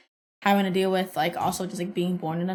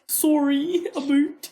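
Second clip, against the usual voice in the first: mock Canadian; 6 semitones higher, much slower than usual. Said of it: "laughing imitation".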